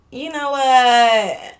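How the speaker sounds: very slow, loud, stretched announcement; drawn-out catchphrase. Retold or imitated. Storyteller fed up with a flirting man, drawn-out catchphrase.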